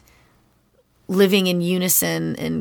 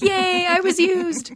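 mock cheer; 11 semitones higher, slower than usual. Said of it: "I was like".

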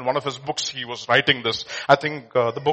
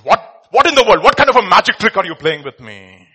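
exasperated demand; 9 dB louder than usual. Acted. Emperor baffled, demanding answer.

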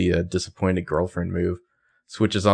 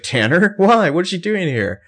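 alarmed; 10 semitones higher, 4 dB louder than usual. Acted. Arya alarmed Tanner is coming.

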